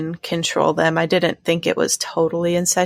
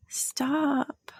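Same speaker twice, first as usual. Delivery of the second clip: whisper; 7 semitones higher, 8 dB quieter than usual. Real, not acted. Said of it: "Keeps doing the pop-up".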